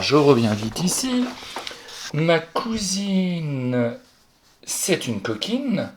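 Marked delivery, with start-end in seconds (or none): 2.1-5.9 very slow, word-by-word dictation; stretched-out classroom dictation. Shown as a demonstration. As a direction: dictate it slowly so the pupil can write it down. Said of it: "C'est même pas ma cousine est une coquine".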